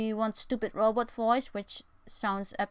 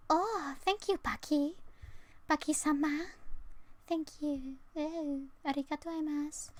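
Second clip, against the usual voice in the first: put-on much higher, sweet anime girl voice; cute anime voice; 7 semitones higher than usual. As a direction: gush thanks like an anime girl. Thanking a donor, anime-style.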